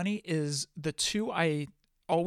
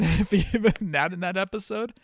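laughing; 4 semitones higher, 4 dB louder than usual. Real, not acted. Joking about brief cameo, laugh heard.

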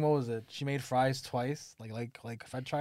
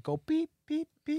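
high-pitched mock beep; censor bleep; 13 semitones higher, slower than usual. Mimicking a censor bleep over the crude line, high beep. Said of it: "censor bleep imitated".

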